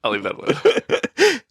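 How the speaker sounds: slow, laughing aside. Real, not acted. Laughs off a jab.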